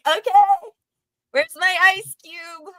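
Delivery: put-on high, eager child asking for the reward; eager little kid. Child who cleaned the room demanding the ice, eager. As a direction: chirp it like a kid expecting a treat. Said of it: "I was like".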